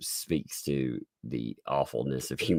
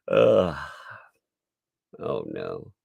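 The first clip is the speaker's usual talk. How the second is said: ordinary talk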